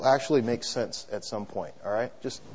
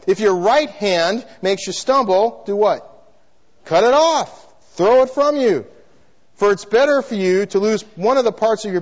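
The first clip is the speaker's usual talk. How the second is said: loud, raised-pitch scripture quotation; forceful preaching; 5 semitones higher, 5 dB louder than usual. Real, not acted. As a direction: preach the verse out with full force. Preacher quotes scripture at full force.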